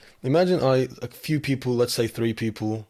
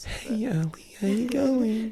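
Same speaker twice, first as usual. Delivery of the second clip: mock greeting; 12 semitones higher, 3 dB quieter, slower than usual.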